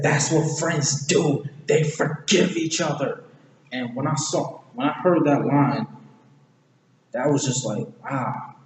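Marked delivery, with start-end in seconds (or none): 0.0-3.2 raised, drawn-out emphatic quote; emphatic acted answer. Retelling Donkey's answer to Shrek, emphatic. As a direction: declare it higher, word by word.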